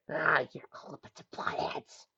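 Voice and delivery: slow, breathless whisper; muffled whisper. Acted. Nick trapped under the bin, muffled whisper.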